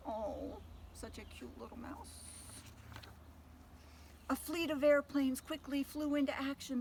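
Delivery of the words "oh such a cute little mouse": much softer cooing aside; tender hushed aside about the mouse. Real, not acted. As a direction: coo it quietly. Aside while reading.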